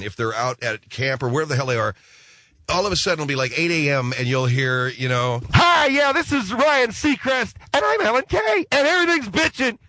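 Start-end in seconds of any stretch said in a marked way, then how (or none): 5.5-9.8 high, loud, hyped-up DJ banter; mock radio DJs. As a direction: squeal it like chirpy morning-show hosts.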